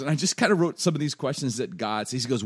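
normal talking voice

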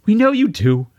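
tearful mother, very high; high-pitched and raspy. A narrator's reading. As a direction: let grief lift the voice high. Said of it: "also speaking with a high-pitched and raspy voice".

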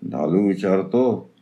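ordinary delivery, nothing marked

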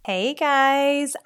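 usual speaking voice

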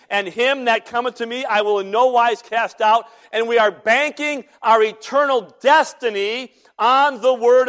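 loud, high-pitched sermon delivery; full-force preaching. Real, not acted. Preacher urging the church to trust God's word, full-force preaching. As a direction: drive it home to the congregation at full volume.

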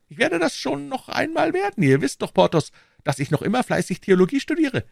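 high-pitched musketeer, self-assured; high, earnest figure voice; mild-mannered Aramis. Acted. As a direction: insist on it in a high voice.

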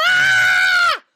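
very high, loud screaming "Ah"; blood-curdling scream. Acted. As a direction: scream in terror at the gunshot. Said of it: "April screamed a blood-curdling scream".

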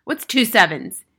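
stern headmistress quizzing; barked question. A narrator's reading. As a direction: fire the question at a pupil. Headmistress quizzing pupil sharply.

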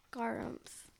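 soft whispered grunt word. Real, not acted. Girl reading the bear's goodbye in a soft whisper.

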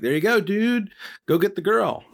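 high, laid-back dude voice; chill Buddha. Retold or imitated. Buddha sending Koa off to the girl, in a high dude voice. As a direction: cheer him on like a relaxed surfer.

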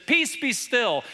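preacher voicing Jesus stilling the wind; commanding call. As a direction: call out to calm the storm. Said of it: "Jesus gets up and he says".

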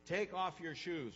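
commanding voice of God from the burning bush. Acted. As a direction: call out the name, then command deliberately. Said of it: "The first words are".

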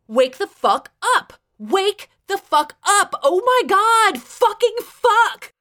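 high, loud, furious screaming. A narrator's reading. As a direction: build to a drawn-out screamed curse. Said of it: "I screamed".

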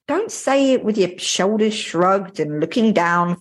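normal talking voice

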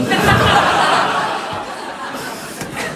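a burst of high, airy laughter; loud laugh. Real, not acted. Preacher laughing at his own bourbon-for-Lent story, loud laugh.

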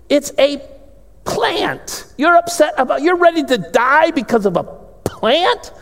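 high, incredulous scolding; a parent amazed at a silly child; exasperated God. Acted. God rebuking sulking Jonah, high and incredulous. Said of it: "And God said".

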